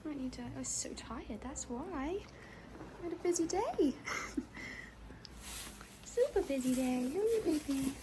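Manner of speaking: high cooing voice to a horse; pet talk. Real, not acted. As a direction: coo softly to a sleepy pony. Baby talk to her horse.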